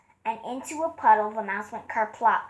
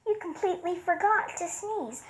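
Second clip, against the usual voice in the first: mouse voice; 6 semitones higher than usual. A narrator's reading. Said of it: "The mouse laughed".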